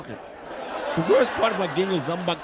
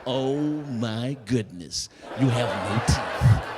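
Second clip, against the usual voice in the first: very slow, low horror; mock-horrified, drawn-out delivery; aghast friend; 4 semitones lower, much slower than usual. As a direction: drag out each word in stunned disbelief. Friend sees the missing teeth.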